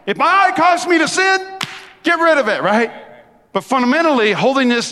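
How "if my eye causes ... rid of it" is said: preaching shout. Real, not acted. Preaching emphasis.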